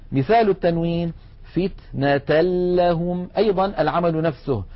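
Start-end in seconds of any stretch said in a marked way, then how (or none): 1.4-3.3 فتنة لهم recited slowly, the t vowel running straight into a doubled l; tanween lost in lam. Shown as a demonstration. Teacher giving the tanween example, doubled l in فتنة لهم. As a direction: join فتنة to لهم on a strong l, no nasal n. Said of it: "ننتقل من التاء إلى اللام المشددة".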